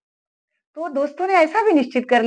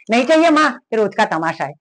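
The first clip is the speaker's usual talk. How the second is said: angry refusal; 5 dB louder than usual. Retold or imitated. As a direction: brush mother off in a huff. Son storms out angrily. (माँ पर झुंझलाकर गुस्से में जवाब)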